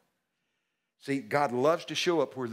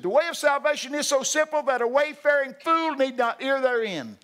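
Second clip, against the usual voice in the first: soaring, loud scripture proclamation; booming verse; 13 semitones higher, 7 dB louder than usual. Acted. Preacher thundering the Bible verse to the congregation.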